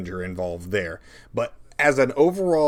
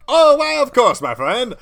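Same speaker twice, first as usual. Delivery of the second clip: much higher and louder, theatrical; hammed-up piano player; 14 semitones higher, 9 dB louder than usual. Piano player answering the players, hammed up and high. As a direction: ham up a gushing tavern musician. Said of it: "No, a different song".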